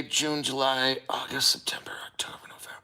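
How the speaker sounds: counting that trails into whisper; fading to a whisper. Real, not acted. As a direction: let the list fade to a whisper.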